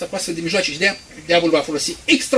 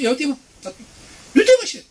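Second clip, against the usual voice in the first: much higher, loud jeering orders; mocking scoffer; 9 semitones higher, 5 dB louder, faster than usual. Preacher mocks scoffers sending someone to check. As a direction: jeer at them, high and loud.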